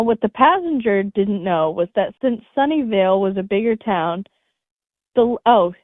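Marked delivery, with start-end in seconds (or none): none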